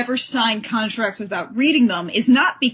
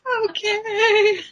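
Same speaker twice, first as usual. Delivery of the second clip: high, slow wailing mock teen voice; mock teen anthem; 11 semitones higher, slower than usual. Mocking the song's defiant teen girl, high and wailing. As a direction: wail it like a melodramatic pop ballad. Mocking Kimber's rebellious song.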